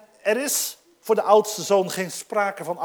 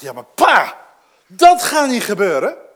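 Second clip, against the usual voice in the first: loud indignant protest; luid protest; 11 dB louder than usual. Pretend. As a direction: shout it back at father. Oudste zoon had moeten protesteren. (luid en verontwaardigd protest)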